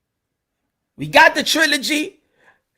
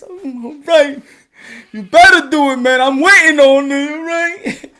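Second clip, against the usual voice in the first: mock wary fan; 2 semitones higher, 6 dB louder, slower than usual. Fan answering boxing.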